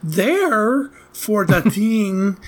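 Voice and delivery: imitating Lucas Haas's vague European accent, drawn out; mock European accent. Podcaster mocking an actor's vague European accent. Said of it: "what is that accent?".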